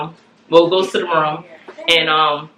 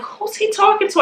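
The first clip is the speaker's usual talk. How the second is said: squeaky worried wondering; high, unsure, half to herself; puzzled high question. Retold or imitated. Listening at the door, puzzled.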